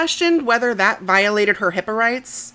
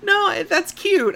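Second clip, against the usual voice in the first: kind gentle laugh; 10 semitones higher, slower than usual. Real, not acted. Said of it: "I'm laughing 'cause it's adorable".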